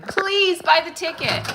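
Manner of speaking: high, loud, drawn-out mock plea; half-joking desperate begging. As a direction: plead with them in a hammed-up beg. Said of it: "I'm, like, begging".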